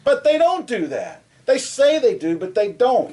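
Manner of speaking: indignant protest. Real, not acted. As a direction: push back hard, pitch jumping up. Heated complaint about tournament regions.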